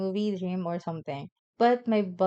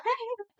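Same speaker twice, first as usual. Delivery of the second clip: high burst of laughter; 4 dB quieter than usual. Real, not acted. Laughs mid-sentence at the memory.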